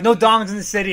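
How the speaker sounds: loud, laughing mock proclamation; mock police order. Police in standoff, acted quote.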